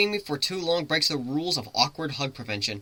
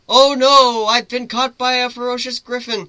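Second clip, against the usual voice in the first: much higher and louder, hammed-up helpless victim; mock damsel distress; 11 semitones higher, 8 dB louder than usual. The human playing captured prey as his griffin carries him off, hammed-up distress. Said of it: "might be fun to do a bit of role play".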